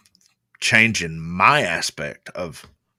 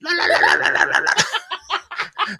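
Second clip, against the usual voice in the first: high rolling raccoon warble; imitated warbling. Raccoon going off underneath the sleeping man, imitated warbling. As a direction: trill a string of high mock warbles. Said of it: "this thing's underneath him going".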